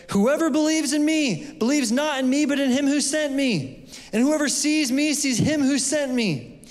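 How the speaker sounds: high ringing cry, voice lifted well above his own; cried out. A narrator's reading. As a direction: call it out to the whole crowd. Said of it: "And Jesus cried out and said".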